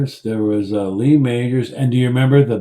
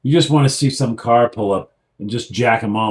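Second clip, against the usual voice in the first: ordinary delivery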